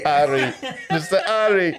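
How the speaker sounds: mock Jamaican accent through laughter; laughing Jamaican accent imitation. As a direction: echo it in a silly Jamaican accent. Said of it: "in a Jamaican accent".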